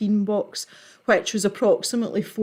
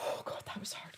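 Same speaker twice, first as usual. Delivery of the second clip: whispering; 21 dB quieter than usual. Real, not acted. Exhausted after long laughing fit.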